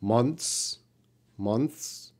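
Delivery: "Months" with a very light, reduced th; native-speaker pronunciation. Shown as a demonstration. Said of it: "This is how a native speaker would say that".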